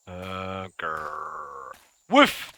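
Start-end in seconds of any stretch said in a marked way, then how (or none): 2.1-2.5 high, loud mock woof; imitated bark. Gamer barking to lure the dogs, high mock woof. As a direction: bark like a dog to call it over.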